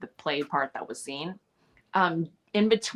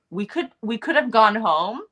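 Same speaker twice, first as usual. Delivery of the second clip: flustered; 5 semitones higher, 6 dB louder than usual. Retold or imitated. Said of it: "a little bit flustered".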